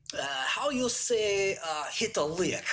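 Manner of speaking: high, slow imitation of a Russian accent. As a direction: play the Russian hacker fumbling for slang. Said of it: "And he was like".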